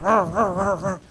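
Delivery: repeated frustrated growls while sweeping; retold wordless angry growling; mad grumbling. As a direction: grumble in short bursts. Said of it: "I'm just mad".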